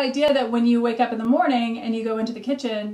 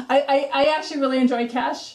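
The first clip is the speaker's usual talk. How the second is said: higher-pitched, amused laughing speech; 5 semitones higher than usual. Real, not acted. Confessing a love of cash to co-host, laughing. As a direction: talk through a real laugh. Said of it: "I'm laughing because".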